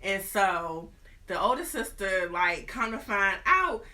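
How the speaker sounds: ordinary talk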